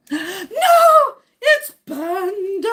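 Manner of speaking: high, loud gasped wail, stretched out; gasp, then wail the name slowly in mock drama; mock-dramatic cry. Streamer spotting Panda in the chat, high drawn-out mock wail.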